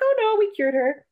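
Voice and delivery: high-pitched imitation of a dismissive doctor; breezy reassurance. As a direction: brush the worry away in a lifted voice.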